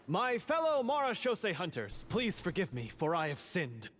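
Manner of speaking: grand, solemn confession, pitched high at the start; overly theatrical. Acted. Confessing grandly to his fellow hunters, hammed-up and solemn. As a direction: proclaim it like a stage hero begging forgiveness. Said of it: "Tone it down".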